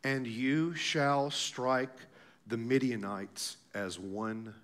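hushed, low voice of God, slow and deliberate; solemn reassurance. Acted. Voicing the Lord reassuring fearful Gideon.

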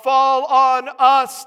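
high, drawn-out shout; slow, very loud preacher's cry; yelling out. Acted. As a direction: yell a desperate plea at full volume. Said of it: "yelling out".

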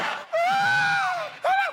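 very high, loud wail; wailing cry, very high; loud cry of anguish. Acted. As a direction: cry out like Jesus on the cross. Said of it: "with a loud voice".

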